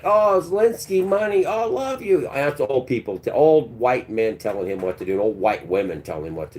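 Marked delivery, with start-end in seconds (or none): 0.0-2.3 high gushing mock adoration; fawning supporters. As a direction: coo at him like a fan. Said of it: "everybody's like".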